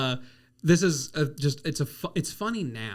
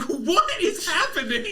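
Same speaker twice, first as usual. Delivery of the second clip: high, slow, laughing confusion; bewildered laughing cry; mock bewildered barista; baffled; 13 semitones higher, slower than usual. Reacting during headset bit.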